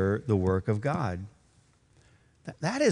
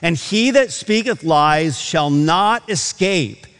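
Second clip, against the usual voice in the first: a preacher pressing the point from the pulpit, louder; fervent preaching; 4 semitones higher, 4 dB louder than usual. Acted. Preacher warning the church about lying, in a fervent raised voice. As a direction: build it like a sermon climax.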